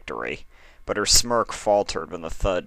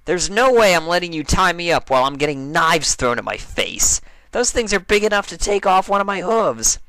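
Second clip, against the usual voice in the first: Rainbow Dash voiced higher and louder, flatly refusing; a brash flyer balking at the idea, pitched up loud; indignant Dash; 10 semitones higher, 6 dB louder than usual. A narrator's reading. Dash refusing to be the target for Applejack, loud and higher.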